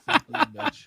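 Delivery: Godfather voice. Real, not acted. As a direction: tough mobster growl, laughing. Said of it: "the Godfather voice".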